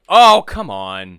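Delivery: loud, slow groan of frustration; a loud, drawn-out "come on"; exasperated outburst. Retold or imitated. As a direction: blurt it out fed up. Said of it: "and then they're like".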